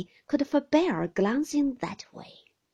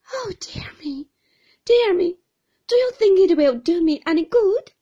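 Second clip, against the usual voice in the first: high, loud helpless wail; helpless young mother crying out to her aunt; 11 semitones higher, 13 dB louder than usual. Acted. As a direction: cry out, lost and helpless. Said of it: "cried my mother in a helpless manner".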